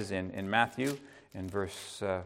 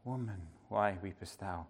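tender; 7 semitones lower, 13 dB quieter than usual. Pretend. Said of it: "with kindness and gentleness".

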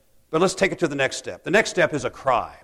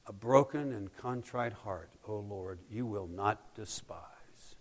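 much softer, slow, fading to a whisper; hushed whisper; 4 semitones lower, 14 dB quieter, slower than usual. Acted. Pastor quoting David's confession, hushed to a whisper. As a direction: breathe the verse out gently. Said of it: "David ... says this".

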